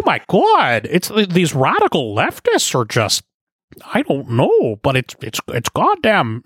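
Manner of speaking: high, swooping mock professor voice; hammed-up Canadian intellectual impression, high and strained for effect; Jordan Peterson. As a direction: rant like an exasperated Canadian academic, pitch jumping high. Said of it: "let's not doing that weird impression".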